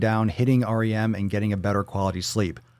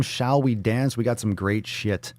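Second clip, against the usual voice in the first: ordinary talk, nothing marked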